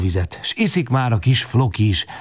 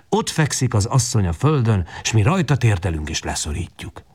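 plain reading voice

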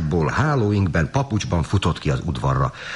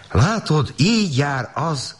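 high, drawn-out storybook wife; lightly acted wifely complaint; high-pitched reproach; 11 semitones higher, slower than usual. A narrator's reading. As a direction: stretch the words out, pitched high. Startled wife talks to husband.

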